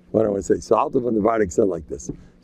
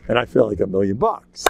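usual speaking voice

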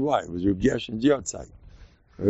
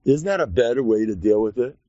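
usual speaking voice

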